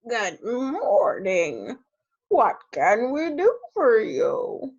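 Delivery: very slow, stately greeting; pompous chief addressing a stranger; chief frog. Acted. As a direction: draw it out ponderously, swooping up to the question.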